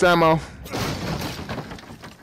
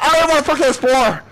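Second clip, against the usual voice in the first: normal talking voice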